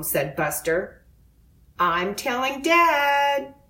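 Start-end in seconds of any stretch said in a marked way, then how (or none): 1.8-3.5 higher, tattletale warning; a mild threat to tell Dad. A narrator's reading. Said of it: "warned D W".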